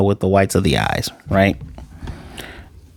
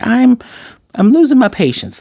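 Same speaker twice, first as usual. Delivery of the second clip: put-on impatient little girl; 13 semitones higher, 3 dB louder than usual. Anya quoted, peeking at Damien.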